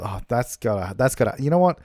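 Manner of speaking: ordinary talk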